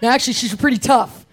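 usual speaking voice

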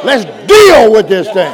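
loud, forceful shout of resolve; bold rallying shout. Acted. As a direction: shout it out, ready to take trouble on. Models a bold answer to trouble.

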